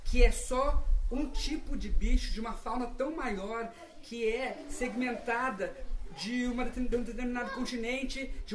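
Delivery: slow solemn. Acted. Guru slow sermon.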